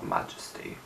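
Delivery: whispered, awestruck; breathy whisper of awe. Real, not acted. Vlogger whispering a favorite song title in awe.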